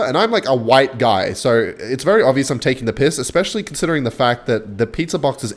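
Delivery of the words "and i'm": ordinary talk, nothing marked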